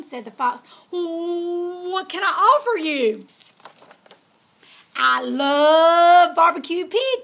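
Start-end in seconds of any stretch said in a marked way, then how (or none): 0.9-3.3 nervous pleading. Acted. Scared pig offering food. 5.0-7.2 loud, slow greedy cry; hungry fox cry. Acted. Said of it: "cried the fox".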